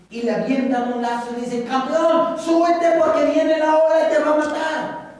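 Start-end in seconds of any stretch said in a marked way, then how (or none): 1.6-5.1 higher, louder urgent call; helicopter rescuer. Acted. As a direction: shout down the rope to the stranded man. Helicopter crew calling down. (rescatista del helicóptero, más agudo y fuerte)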